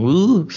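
loud, rising mock groan of annoyance; acted-out exasperated "uh"; frustrated groan. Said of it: "Kesel saya, kesel". (erangan kesal, keras dan tinggi)

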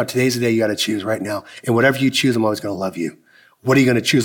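low, soft, earnest; earnest plea. Retold or imitated. Mentor tells his friend God is calling him, low and earnest. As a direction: lean in and plead with love.

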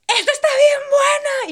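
high, slow, loud imitated yell of delight; imitated shout. Ana shouting about the pizza in the Costco food court, loud and high. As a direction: belt it out like she's hollering across the store. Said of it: "la Ana grita".

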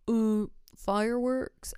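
botched attempt at a neighbor's accent, drawn out; slow, half-hearted West Virginia accent; failed accent attempt. Retelling a neighbor's reply about fireworks, failed accent attempt. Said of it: "I don't know what accent that was".

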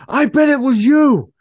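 high, loud, slow barked accusation; drill sergeant. Retold or imitated. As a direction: bark it like a drill sergeant in his face. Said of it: "like a drill sergeant was like".

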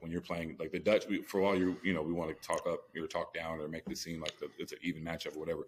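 ordinary talk

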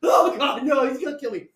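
loud, high mock-terrified wail; acted crying panic. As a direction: re-enact a tearful plea for your life. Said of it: "I'm fucking crying".

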